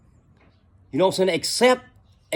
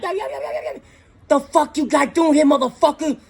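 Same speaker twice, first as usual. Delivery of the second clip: imitating an angry landowner; 8 semitones higher than usual. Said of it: "he came with attitude".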